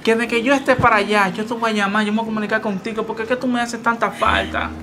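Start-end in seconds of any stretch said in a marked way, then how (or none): 1.3-4.7 softer, tender comforting words; tender reassurance. Acted. Husband soothing his wife before leaving, softly. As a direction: hush her gently.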